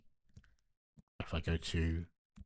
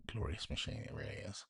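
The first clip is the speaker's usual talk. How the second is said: reverent whisper; 2 semitones higher, 12 dB quieter than usual. Real, not acted. Adoring whisper repeated.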